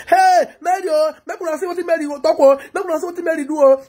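high, loud acted call-out; imagined public outcry. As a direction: cry out as if summoning onlookers. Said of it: "use him come out for show me".

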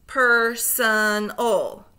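slow, split into syllables; segmented syllables. Shown as a demonstration. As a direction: chop Personal into slow syllables. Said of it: "say the syllables in segments".

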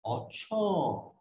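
imitated film catchphrase, "अच्छा" drawn out slowly; Aamir Khan. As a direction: stretch "अच्छा" long, sliding down at the end. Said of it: "उसने अच्छा के ऊपर stress".

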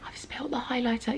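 hushed confession; whisper. Real, not acted. Hiding the spill from her husband, whispered. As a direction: whisper it guiltily.

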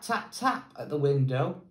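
ordinary delivery

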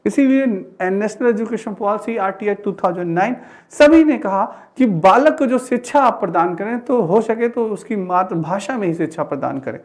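usual speaking voice

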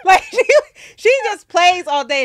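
high and loud, laughing as she talks. Real, not acted. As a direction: crack up mid-sentence. Laughing while telling story.

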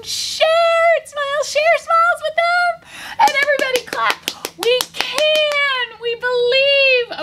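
very high sing-out chant; rally the kids, high and stretched out; rallying cheer. Pretend. Storyteller leads children clapping, cheering high.

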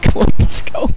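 loud, breathy rally cry; rallying shout. Real, not acted. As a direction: fire up the team. Rallying teammates in game.

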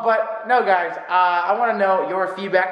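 usual speaking voice

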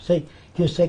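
usual speaking voice

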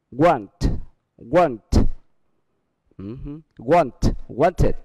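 "wanted" ending in an extra id syllable. Shown as a demonstration. Teacher contrasting "want" with "wanted" for students.

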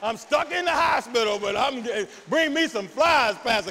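mock bold sick woman, getting loud; fired-up patient. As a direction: pastor hamming up the sick woman's zeal. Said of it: "But she was like".

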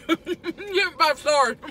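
laughing. Real, not acted. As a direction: laugh through the praise. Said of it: "repeat laughing".

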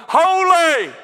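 high, loud cry of an angel. Acted. Seraph calls to another seraph before God's throne, crying out. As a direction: call it out like a seraph across the throne room. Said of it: "one is crying to the other".

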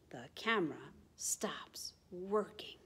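dropped to a whisper; suspense whisper. A narrator's reading. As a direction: whisper the letdown. Sudden problem in story.

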